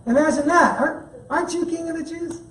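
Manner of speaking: high, teasing taunt with a hint of a put-on voice; mocking Magi. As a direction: poke fun at the king, lightly voiced. Magi taunting King Herod.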